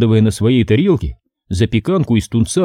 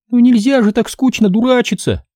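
scornful, much higher retort; contemptuous; 12 semitones higher than usual. A narrator's reading. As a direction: sneer at the boy's joke. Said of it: "бросила она".

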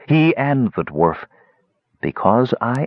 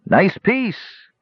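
high, greedy goblin voice; 11 semitones higher than usual. Acted. Goblin admires the dagger.

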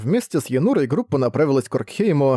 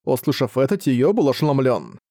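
usual speaking voice

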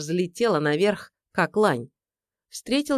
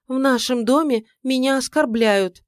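fake offended whine; 7 semitones higher, 4 dB louder than usual. Wife complaining theatrically.

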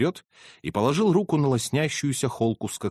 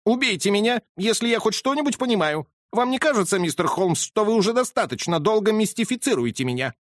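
higher, agitated colonel; exasperated colonel; 9 semitones higher than usual. Acted. Colonel presses Holmes for answers, higher and agitated. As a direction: demand answers impatiently.

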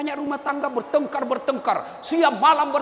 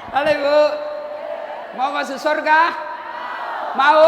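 loud, higher call to the audience; 4 semitones higher, 4 dB louder than usual. Real, not acted. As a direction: rouse the congregation. Preacher calling out to audience. (memanggil jamaah dengan suara keras)

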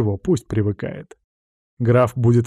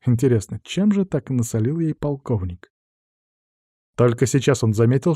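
ordinary delivery

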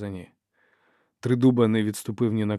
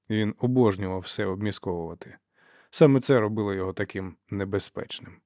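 ordinary delivery, nothing marked

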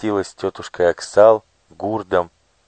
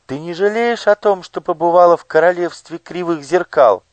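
much higher, ringing magic mirror voice; ringing crystal chime voice; 11 semitones higher, 5 dB louder than usual. Acted. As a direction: ring out like crystal glass. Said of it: "красивый звенящий голос".

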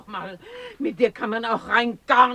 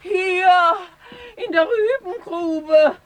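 higher and louder, half crying, half laughing; calling from the pit; 10 semitones higher, 4 dB louder than usual. Acted. Oma shouts up to Kalle from the pit, half crying. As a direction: shout up from below between sobs and laughter.

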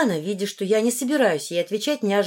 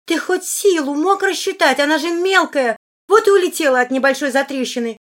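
high, loud, heated scolding; indignant scolding; 7 semitones higher, 6 dB louder than usual. A narrator's reading. Сильвана telling off Руфус for hitting too hard, indignant. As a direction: scold him in a raised, high voice.

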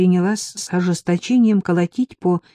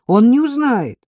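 high, louder reassurance; persuading; 7 semitones higher, 5 dB louder than usual. A narrator's reading. Narrator coaxing frightened Marika to stay over, persuading. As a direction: press the point to win her over. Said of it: "убеждала я".

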